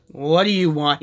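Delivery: loud, gruff demand at the door; shouted. A narrator's reading. Lord Gur shouting at Minnie at his door, gruff. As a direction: bark it out, annoyed. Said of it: "he shouted".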